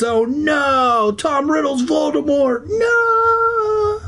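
high hammed-up terrified cry; mock terror. Reader joking about Voldemort, hammed-up shriek. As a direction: shriek it in pretend horror.

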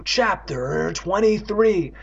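very slow, high-pitched heading; dramatic chapter announcement. Acted. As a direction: draw the chapter number out slowly.

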